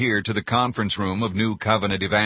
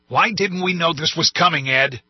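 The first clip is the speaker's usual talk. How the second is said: raised, demanding voice, higher and louder; angry president; 8 semitones higher, 4 dB louder than usual. A narrator's reading. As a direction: demand answers furiously. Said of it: "President Gerald Fitzhugh said".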